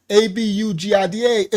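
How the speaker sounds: abugida spelled letter by letter. Shown as a demonstration. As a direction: spell out A B U G I D A one letter at a time. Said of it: "While an abugida".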